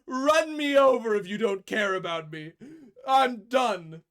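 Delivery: loud, high, melodramatic cat wail; dramatic cat voice. Retold or imitated. Voicing the sulky cat lying behind the car wheel, loud and high. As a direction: overplay a sulky cat flinging itself down. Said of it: "Just like".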